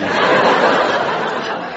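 an unvoiced fit of laughing; helpless wheezing laugh; breathless laugh. Real, not acted. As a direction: crack up at your own joke. Breaks up after the joke.

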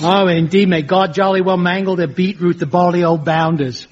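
hammed-up jolly English accent; mock English bluster; fake English accent. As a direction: overdo a phoney English accent. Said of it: "My English accent was pretty impressive".

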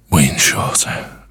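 breathless, airy, slowing down; winded, gasping between words; out of breath. Real, not acted. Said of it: "let me just catch my breath".

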